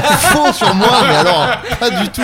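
laughing. Real, not acted. Teased, denies while laughing.

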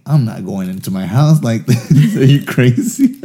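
retold panic under a helicopter spotlight; loud panicked refusal. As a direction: blurt it while running from a spotlight. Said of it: "And then I'm like".

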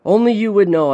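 high, loud call across the ice. A narrator's reading. Son testing the voice below, calling out. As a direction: call it out to the water. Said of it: "I called".

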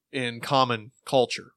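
usual speaking voice

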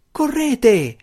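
high, loud urgent cry; urgent shout to companions to run; shouted warning. A narrator's reading. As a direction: yell at them to run. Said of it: "urlò ai suoi compagni".

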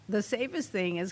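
ordinary delivery

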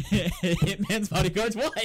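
loud, high, slow through laughter; real laughter breaking into the words; laughing punchline. Real, not acted. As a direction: crack up while announcing the title. Cracking up at own joke.

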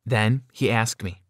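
ordinary delivery, nothing marked

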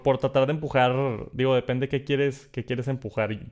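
usual speaking voice

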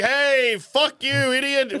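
mock chorus jeer. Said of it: "people to be like".

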